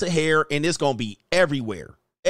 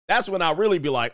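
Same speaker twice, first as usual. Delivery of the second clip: usual speaking voice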